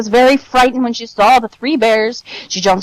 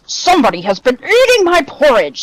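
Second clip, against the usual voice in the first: big gruff bear storyteller voice, raised and emphatic; lightly voiced gruff father bear; 6 semitones higher, 3 dB louder than usual. A narrator's reading. Father Bear finds his porridge eaten, gruff and indignant. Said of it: "in his great, gruff voice".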